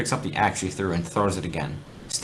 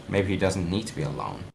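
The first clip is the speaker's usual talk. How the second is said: ordinary delivery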